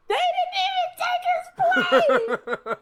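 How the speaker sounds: cracking up while shouting the line; laughing disbelief. Real, not acted. Repeats it as laughing outburst.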